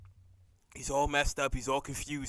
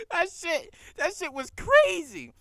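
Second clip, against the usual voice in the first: high, loud laugh into the words; laughing hard; 8 dB louder, slower than usual. Real, not acted. Viewer cracking up over a crazy scene, laughing high and loud. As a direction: laugh it out in disbelief.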